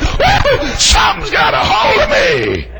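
loud re-enacted shout of being seized; acted Holy Ghost seizure. Re-enacts Holy Ghost running up leg.

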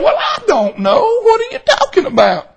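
high-pitched imitation of a baffled wife; puzzled wife. Wife answers husband reaching for his pocket, puzzled imitation. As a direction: lift the voice high and sound clueless.

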